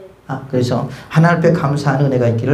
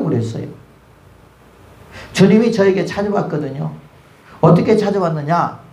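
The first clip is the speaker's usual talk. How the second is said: ordinary delivery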